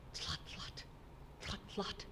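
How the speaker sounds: soft unvoiced hoofbeat clicks; whispered hoofbeats. Shown as a demonstration. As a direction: tap out distant hooves in a whisper. Distant hoofbeats imitated.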